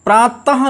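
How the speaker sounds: प्रातः with a full voiced ha at the end; wrong visarga. Shown as a demonstration. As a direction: say प्रातः as praa-ta-ha. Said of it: "नहीं".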